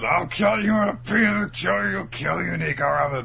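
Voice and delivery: high, loud mock death threat, repeated frantically; murderous ranting. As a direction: rant like a hammed-up killer, easing at the end. Acting Hakuba's murderous ranting.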